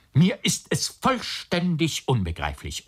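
high-pitched, baffled exclamation; exasperated outburst. A narrator's reading. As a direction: burst out in utter bafflement. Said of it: "in verschiedene Ausrufe ausbrach". (fassungsloser, hoher Ausruf)

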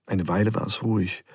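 plain reading voice

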